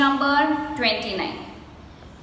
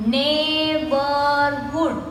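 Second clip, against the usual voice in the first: slowly broken up; much slower than usual. Shown as a demonstration. Teacher counting three syllables, "Neighborhood" slowly broken up. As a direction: stretch each syllable of "Neighborhood". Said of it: "we say it slowly and we break the word".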